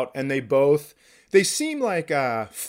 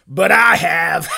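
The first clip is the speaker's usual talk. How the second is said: slow, high, loud confession, half laughing; loud drunken confession; loud drunk blurt; 4 semitones higher, 9 dB louder, slower than usual. Retold or imitated. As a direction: blurt it out like a drunk who can't help it. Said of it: "Willie goes".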